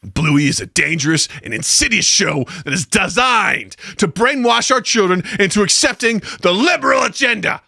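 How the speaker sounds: pundit rant climbing high again; Alex Jones impression. Co-host warns parents off the cartoon as a ranting pundit climbing in pitch. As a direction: build to an alarmed, emphatic warning, pitch rising. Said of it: "read it in Alex Jones' voice".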